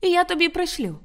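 very high-pitched parting call. A narrator's reading. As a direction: call back through the door. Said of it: "гукнула вона йому вже крізь двері".

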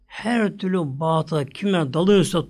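ordinary talk

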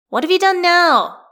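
high, loud suspicious call. Acted. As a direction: call through the apartment, wary. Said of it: "he calls".

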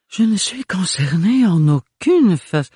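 high, slow, affected grande dame; pompous lady. A narrator's reading. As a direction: play it with mock dignity. Pompous Cunégonde cut off.

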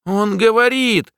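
high, slow and stubborn. A narrator's reading. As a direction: insist like a stubborn child. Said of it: "упрямо повторил Блик".

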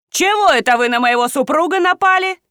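high-pitched wifely outrage; indignant. A narrator's reading. Wife defending her husband from friends, indignant. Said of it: "возмутилась Надежда".

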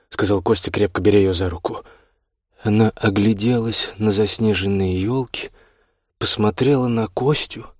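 ordinary delivery, nothing marked

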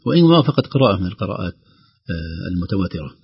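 ordinary talk, nothing marked

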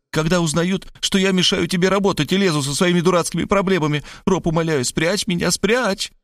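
high, fast panicky pleading; frightened gabble. A narrator's reading. Varvara begging Rob to hide her, frightened. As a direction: rattle it off high, scared. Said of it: "испуганно затараторила".

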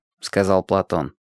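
plain reading voice

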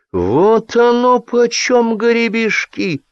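high, drawn-out forced horror; strained horror. A narrator's reading. Staffer faking horror to look active, strained. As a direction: overdo the shock in a high voice. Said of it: "натужно ужаснулся он".

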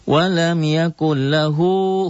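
slow, high melodic tartil chant; Quran recitation. Shown as a demonstration. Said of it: "teacher recites Quran verse". (lantunan tartil pelan)

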